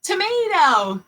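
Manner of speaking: high, slow "Tomato" cheer; excited reveal. Shown as a demonstration. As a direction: stretch "Tomato" out proudly.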